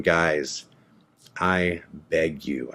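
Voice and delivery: low, soft retold plea; begging. Detained man begging the border agents, softly pleading. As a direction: plead quietly, slowing on the last words. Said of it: "like, begging them".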